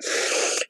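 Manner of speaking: sharp unvoiced gasp; soft teary gasp; emotional gasp. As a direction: gasp as tears come. Holding back tears.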